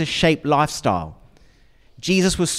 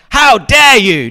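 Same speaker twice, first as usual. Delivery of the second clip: loud, slow, high indignant snap; hammed-up how-dare-you; mock outrage; 8 semitones higher, 10 dB louder, slower than usual. Parent mock-scolding a child who fell, outraged. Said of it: "you don't say to the child".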